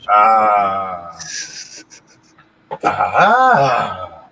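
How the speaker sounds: loud drawn-out ah; loud groaning ah. Real, not acted. Reacting to friend's joke.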